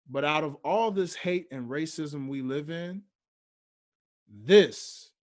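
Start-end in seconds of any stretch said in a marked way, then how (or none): 4.3-5.1 "this" punched, much louder; stress on THIS. Shown as a demonstration. Podcaster reading a post to listeners, "this" punched loud. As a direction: hit the word "this" hard and alone. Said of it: "and he emphasized the word this".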